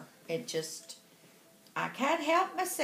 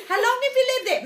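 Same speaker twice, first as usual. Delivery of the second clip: high, loud mock English lady; English accent; 10 semitones higher, 6 dB louder, much faster than usual. English lady asking how long, high loud English accent. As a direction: ask loudly in an imitated English accent. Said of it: "from England".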